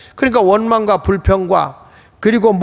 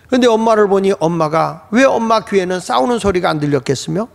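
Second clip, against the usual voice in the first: ordinary talk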